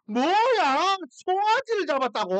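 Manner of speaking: loud, high-pitched indignant outburst; furious outburst. Retold or imitated. Elder son hearing about the slaughtered calf, furious disbelief. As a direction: act out an outraged brother, voice shooting up. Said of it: "노발대발하면서".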